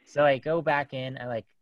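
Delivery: normal talking voice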